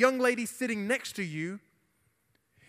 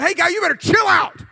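high, loud angry challenge; worked-up shouted warning; mad retold shout; 11 semitones higher, 13 dB louder than usual. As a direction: re-enact yelling at a bully. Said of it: "And I was so mad".

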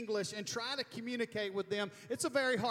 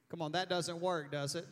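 ordinary talk

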